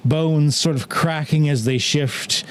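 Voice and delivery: ordinary talk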